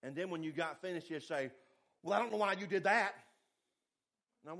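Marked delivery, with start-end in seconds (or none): 2.0-3.1 louder, faster, scolding boss; gruff supervisor. Retold or imitated. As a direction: bark it like an angry old bull of a boss. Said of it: "like he was an angry old bull".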